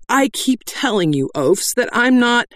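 high, exasperated protest; aggrieved. A narrator's reading. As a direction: insist in frustration. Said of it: "Doll's voice was aggrieved".

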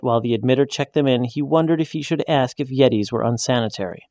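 plain reading voice

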